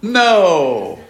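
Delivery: booming, drawn-out cry, high then falling; emphatic loud answer. Shown as a demonstration.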